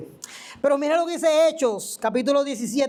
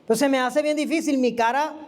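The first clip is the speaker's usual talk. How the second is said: ordinary talk, nothing marked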